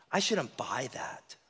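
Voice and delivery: breathy unvoiced whisper; guilty whisper; whispered inner voice. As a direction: whisper to yourself. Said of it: "two sides".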